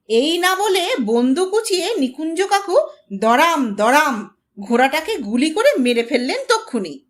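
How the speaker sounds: plain reading voice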